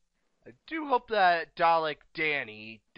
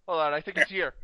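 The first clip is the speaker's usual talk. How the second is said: ordinary talk, nothing marked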